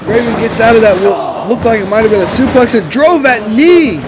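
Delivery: rising, excited play-by-play call; wrestling commentator. Acted. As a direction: call the match like a ringside announcer.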